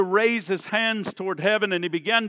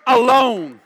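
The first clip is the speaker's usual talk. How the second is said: commanding shout; 5 semitones higher, 9 dB louder, slower than usual. Acted. Jesus said.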